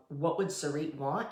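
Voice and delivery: normal talking voice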